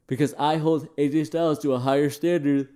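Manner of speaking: words pulled out of shape by a yawn. Real, not acted. Tired reviewer of a long pay-per-view, speaking mid-yawn. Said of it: "You can tell this pay-per-view was very long as I'm yawning".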